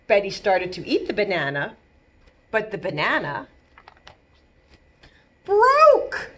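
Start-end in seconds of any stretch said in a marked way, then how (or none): 5.4-6.3 dramatic surprise. Acted. Dramatic storytelling climax.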